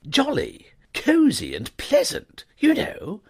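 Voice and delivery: high, rambling gentleman; contented upper-class chatter; chatty young toff. A narrator's reading. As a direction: warm praise tumbling out. Wooster voiced.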